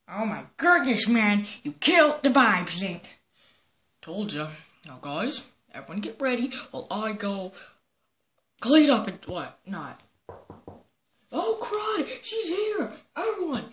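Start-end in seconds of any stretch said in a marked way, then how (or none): none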